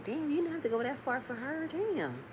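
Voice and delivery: very soft, high-pitched protest; retold hushed dismay; dismayed reaction.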